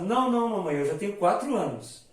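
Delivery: criança corrigindo a mãe, mais aguda e mais alta; criança protestando. Retold or imitated. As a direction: imite uma criança teimosa de quatro anos. (criança corrigindo a mãe, voz infantil mais aguda e mais forte)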